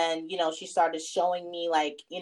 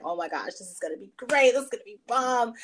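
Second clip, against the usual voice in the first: retold excitement; 5 semitones higher, faster than usual. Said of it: "I'm stoked. I'm excited".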